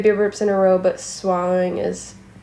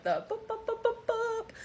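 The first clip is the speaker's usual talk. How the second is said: high little boop sounds; tiny burp boops; 11 dB quieter than usual. Shown as a demonstration. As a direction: demo the small boops. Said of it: "I can do, like".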